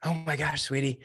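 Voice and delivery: a hint of a delighted mother; retold mother's voice. Mom answering her son's call, lightly imitated surprise. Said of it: "And she said".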